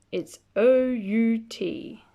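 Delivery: slow, each letter O U T stressed; spelled out slowly. Acted. Child explaining Mother's firm no, letters spelled slowly.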